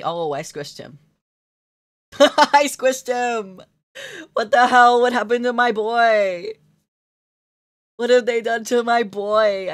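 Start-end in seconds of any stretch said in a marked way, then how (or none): 2.2-9.7 loud, high, drawn-out mock wail; mock outrage. Creator wailing over the squished boy, mock outrage. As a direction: cry out in hammed-up horror, high and loud.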